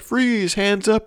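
high barked police order; mock cop yell. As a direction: bark it like a cop storming in. Police burst in.

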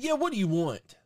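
high, loud retold yell; screaming drive-thru worker. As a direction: imitate an annoyed worker's holler. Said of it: "you don't scream at fucking people".

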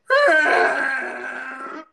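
high, loud shrieking dinosaur roar; dinosaur impression. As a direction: screech like a plant eating dinosaur. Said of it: "That was my dinosaur impression".

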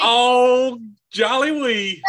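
loud, high, drawn-out mock cry; hammed-up exclamation. Host teased about North Carolina roots, hamming it up. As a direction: stretch it out in playful exaggeration.

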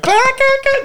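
shrill burst of laughter; very high cackle of real laughter; high squealing laugh. Real, not acted. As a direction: lose it completely. Laughing hard.

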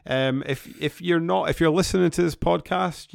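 hoarse from the flu; rough, croaky voice after illness. Real, not acted. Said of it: "wanna see my croaky voice".

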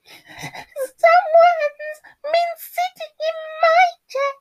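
very high, slow, loud cub wail; crying Baby Bear. A narrator's reading. Baby Bear finds his chair used, high loud cry. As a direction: wail it squeaky like a little bear. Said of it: "cried Baby Bear".

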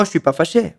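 "je suis pas fâché" with "je suis" blended into "chui"; relaxed spoken "je suis pas fâché"; je suis slurred. Shown as a demonstration. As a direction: casually run "je suis" into one sound. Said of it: "suena suis".

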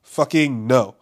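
loud, emphatic refusal; retold denial. Man retelling his reaction to Kobe's death, in loud denial. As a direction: push the news away forcefully, word by word. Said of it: "In denial, like".

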